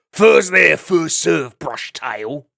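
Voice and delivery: a lightly voiced loud weasel snarl. Weasel racing the fox, a mild loud snarl. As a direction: snap it at the fox.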